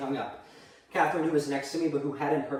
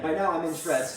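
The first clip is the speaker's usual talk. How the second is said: ordinary talk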